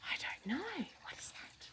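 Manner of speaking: high, hushed whisper. Real, not acted. Wondering with the child, a nervous whisper. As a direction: whisper as if afraid to be heard.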